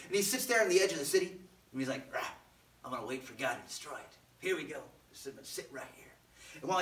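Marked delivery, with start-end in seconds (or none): 2.1-6.0 grumbling under the breath, trailing softer; quiet, acted huffy muttering; grumpy Jonah. Jonah settling on the hill to wait, grumpy mutter. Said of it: "As he's sitting there all grumpy".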